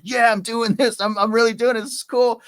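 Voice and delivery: high, loud self-hype; pumped up. Retold or imitated. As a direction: cheer himself on, riding high. Pumped up on the roofs.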